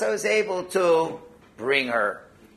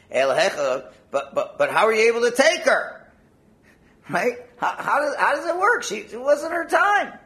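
much higher, louder astonished asking; amazed question; 9 semitones higher, 5 dB louder than usual. Acted. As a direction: ask in disbelief how it worked. Rav Bibi asks in amazement.

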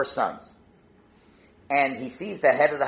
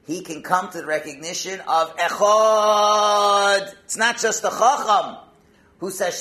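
loud, raised, driving sermon climax; impassioned preaching; 6 semitones higher, 8 dB louder than usual. Real, not acted. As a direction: drive the lesson home, holding the key word long.

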